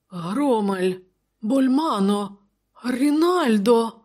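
high, rising dismayed cries; aghast exhalation of names. A narrator's reading. Royal counsellor finding the corpses, aghast. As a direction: call out each name in mounting shock. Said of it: "видихнув, побачивши трупи, Публій".

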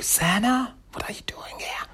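urgent whisper, no voice at all. Retold or imitated. Ninja whispers to the little weasel, whispered urgently. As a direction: hiss the name under your breath.

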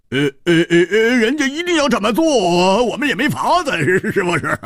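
high, hemming, mock-reluctant sneer; feigned sneer. A narrator's reading. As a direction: pretend to be cornered. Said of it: "故意冷笑着说".

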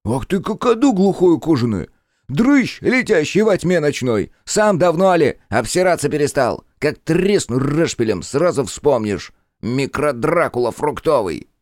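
high, loud furious tirade; furious shout. Acted. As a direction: scold him in a rage. Jester furious at bat.

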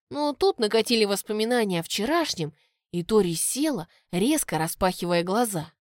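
usual speaking voice

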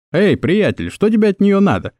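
mildly stern challenge, pitch lifting on the question. A narrator's reading. Bystander challenges a man bothering a young woman, mildly stern. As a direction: challenge him with a touch of sternness. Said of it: "строгим тоном спросил кто-то".

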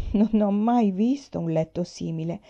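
ordinary delivery, nothing marked

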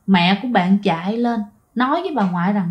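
usual speaking voice

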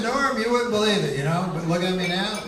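bright boast, pleased with himself; quoting friend. Retold or imitated. Comic quoting his obese friend bragging, lightly voiced. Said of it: "here's what he said to me".

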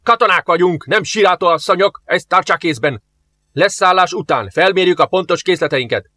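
officer ending the panic with a roar; shouting. A narrator's reading. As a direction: bark orders at the frightened crew. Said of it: "Az első tiszt akkorát kiáltott".

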